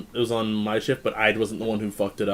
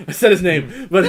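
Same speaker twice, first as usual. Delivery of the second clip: laughing; 6 semitones higher, 6 dB louder than usual. Real, not acted. Laughing at own slip.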